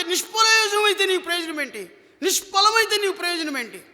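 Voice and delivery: high-pitched, insistent sermon delivery; forceful preaching. Real, not acted. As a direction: drive the rebuke home with sustained high pitch.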